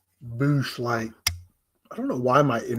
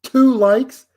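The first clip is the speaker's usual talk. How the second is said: shocked disbelief; 8 semitones higher, 6 dB louder than usual. Real, not acted. Streamer staring at his like count, shocked disbelief. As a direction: pitch jumps up high, louder, incredulous.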